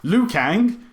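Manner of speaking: hammed-up expository introduction; slow, laughing parody of a clunky film introduction; clunky exposition. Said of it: "Just like, you know".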